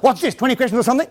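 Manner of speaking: fast, exasperated mock-teen comeback; irritated teenager. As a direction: play a teen fed up with questioning. Acted teen resisting parent's questions.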